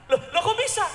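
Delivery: high, loud, laughing disbelief; surprised half-laughing outburst; laughing surprise. Retold or imitated. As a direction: loh loh, act shocked with a laugh. Pastor acts his own surprise.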